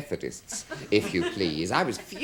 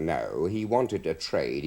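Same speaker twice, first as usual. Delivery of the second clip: usual speaking voice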